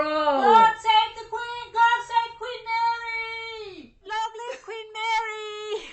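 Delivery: high, loud, drawn-out chant of a loyal crowd; sing-song cheer for the queen. Pretend. Cheering the queen after speech.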